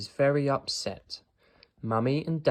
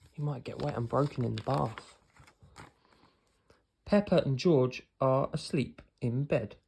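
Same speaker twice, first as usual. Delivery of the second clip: ordinary delivery, nothing marked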